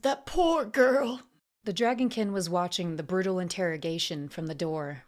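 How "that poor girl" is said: high, slow, loud lament; distraught pity. A narrator's reading. Auctioneer grieving for the taken girl, distraught. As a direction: lament it, drawn out.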